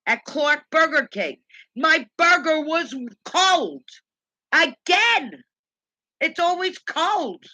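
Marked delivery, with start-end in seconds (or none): 1.7-7.4 high, slow, drawn-out complaint; real fed-up rant, pitched well up. Real, not acted. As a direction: stretch out each grievance in exasperation. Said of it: "me ranting and raving".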